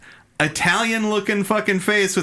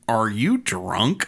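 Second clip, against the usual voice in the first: low, slow, mildly mock-Vulcan question; lightly voiced logical alien, slow; deadpan Vulcan; 6 semitones lower, slower than usual. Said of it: "he's really Vulcan about it".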